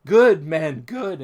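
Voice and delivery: a hint of an eager kobold fawning on men. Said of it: "he says".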